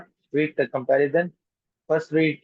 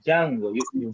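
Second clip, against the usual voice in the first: ordinary talk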